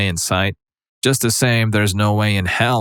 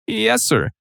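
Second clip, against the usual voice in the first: timid squeak; 14 semitones higher than usual. Retold or imitated. Scared salesman answering biker.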